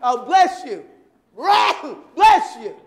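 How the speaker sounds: loud, forced repeated bless you; hammed-up loud blessing; mock loud blessing. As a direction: push each blessing out in annoyance.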